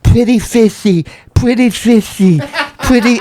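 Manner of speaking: high, loud, slow sing-song call; calling the fish in a high mock sing-song; imitating brother. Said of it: "He goes".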